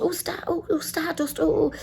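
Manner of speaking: high, fumbling mock-eager answer; like eager kids. Passengers racing to answer the quiz, like eager kids. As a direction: grope for the answer like a keen kid. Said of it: "we were like kids".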